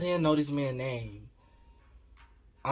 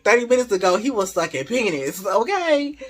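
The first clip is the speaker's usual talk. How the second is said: sassy retort; 6 semitones higher, 5 dB louder than usual. Retold or imitated.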